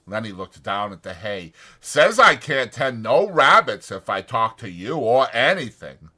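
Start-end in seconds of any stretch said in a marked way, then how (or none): 1.8-6.1 loud, anxious simple man; Lenny's worried voice. A narrator's reading. Lenny frets to himself over the dead puppy, loud and anxious. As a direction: fret out loud about George finding out.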